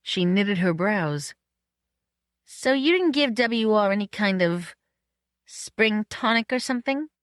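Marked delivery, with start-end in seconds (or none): 2.4-7.1 puzzled Marion. Acted. Voices Marion.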